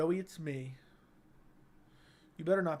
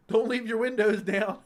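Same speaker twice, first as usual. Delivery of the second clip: higher and louder, laughing through it; laughing warning; 4 semitones higher, 5 dB louder than usual. Real, not acted. Streamer to chat about the car prank, laughing warning. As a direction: warn them while cracking up.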